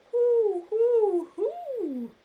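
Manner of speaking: high, loud hooting owl; owl hoots. Acted. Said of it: "says the owl".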